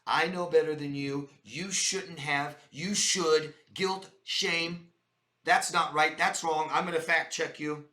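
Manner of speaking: loud, bossy know-it-all lecturing; authoritative. Retold or imitated. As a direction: talk down to them loudly. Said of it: "The other one is authoritative".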